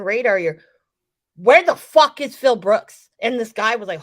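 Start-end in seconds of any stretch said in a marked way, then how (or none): 1.4-3.1 loud, drawn-out demand; slow, heavy shout across a crowd; loud demanding outburst. Retold or imitated. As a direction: bellow it slowly, punching the swear word. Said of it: "I said it just like that too".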